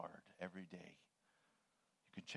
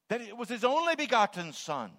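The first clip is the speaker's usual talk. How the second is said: ONLY begotten Son pushed high and loud; stress on ONLY; 13 semitones higher, 13 dB louder than usual. Shown as a demonstration. Preacher emphasizing something different in the verse, stressing only. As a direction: lift "only" high and lean on it. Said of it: "just emphasizing something different".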